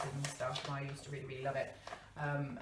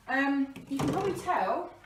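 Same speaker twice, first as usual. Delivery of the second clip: talking with a sneeze building; about to sneeze; 9 semitones higher, 7 dB louder than usual. Real, not acted. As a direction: sneeze coming on. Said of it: "I'm gonna sneeze a sec".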